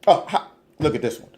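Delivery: normal talking voice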